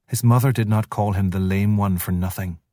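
usual speaking voice

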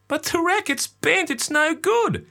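put-on very high little boy voice; child protesting to dad. A narrator's reading.

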